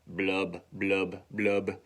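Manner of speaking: low, separated blubs; bubbling sound imitation. Earring sinks in fish tank, low bubbly blubs. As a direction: drop each blub like a rising bubble.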